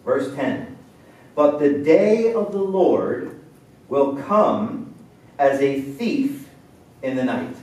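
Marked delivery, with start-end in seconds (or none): 1.3-7.5 solemn reading. Acted. Solemn scripture reading, slowed.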